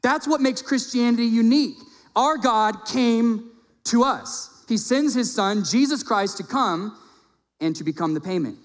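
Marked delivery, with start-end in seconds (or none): 0.0-7.0 high, loud preaching with punched words; impassioned preaching. Acted. Preacher proclaims Christianity's difference to congregation, impassioned. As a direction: preach it high and forceful, drawing out key phrases.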